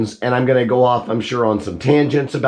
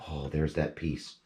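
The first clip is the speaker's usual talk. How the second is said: muttered aside; 8 semitones lower, 13 dB quieter than usual. Real, not acted. Muttered aside while dismantling.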